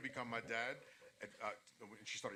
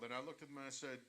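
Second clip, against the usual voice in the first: ordinary talk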